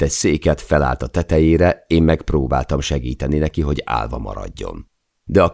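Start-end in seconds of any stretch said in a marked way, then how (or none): none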